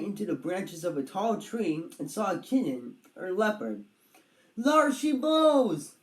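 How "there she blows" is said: slow, loud, higher call; cried out. A narrator's reading. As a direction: sing it out like a lookout spotting a whale. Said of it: "cried Padraig".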